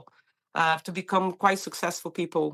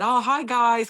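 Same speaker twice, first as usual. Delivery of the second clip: higher, bright greeting to new colleagues; chirpy hello to the room; eager newcomer greeting; cheery hello; 6 semitones higher, 4 dB louder, slower than usual. Retold or imitated.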